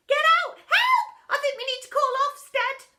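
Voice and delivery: very high, loud panicked shouts; panicked kids. A narrator's reading. Pupils fleeing the gunge, panicking. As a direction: scream for help.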